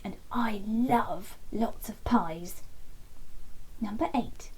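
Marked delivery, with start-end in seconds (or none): none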